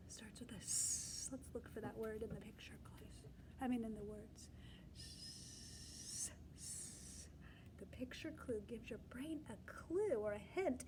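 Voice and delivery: hushed teacher whisper. Real, not acted. Teacher whispering a clue to the class. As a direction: whisper it like a secret. Whispering letter hint to kids.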